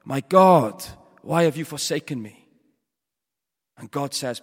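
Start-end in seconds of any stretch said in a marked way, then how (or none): 0.0-2.5 loud acted cry of abandonment. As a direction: cry out to God at full volume. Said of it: "he cries out in the dark".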